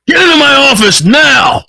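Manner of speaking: high furious shout; angry boss. Acted. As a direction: bellow at him like a furious boss. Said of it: "he sees him".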